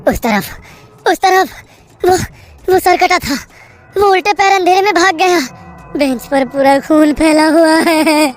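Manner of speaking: high, loud, frantic pointing and explaining; terrified babbling. Acted. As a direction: babble it in panic. Narrator terrified explaining ghost.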